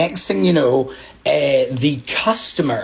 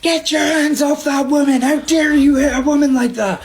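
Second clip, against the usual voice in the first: retold shouting; 11 semitones higher than usual. Bystanders shouting at the bartender, high indignant mock yell. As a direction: call out like an outraged bystander, half-mocking. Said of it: "these people are shouting at me".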